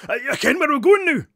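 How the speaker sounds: high, loud, barely intelligible Scots; thick Scottish accent. A narrator's reading. Bearded ghost speaking to baffled children, thick Scottish accent. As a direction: burst out in impenetrable Scottish accent. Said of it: "Barry is Scottish".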